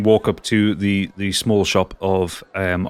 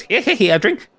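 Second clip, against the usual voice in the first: high, giddy creature delight; creepy giggly voice; 10 semitones higher than usual. Acted. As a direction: coo over him like a mad collector.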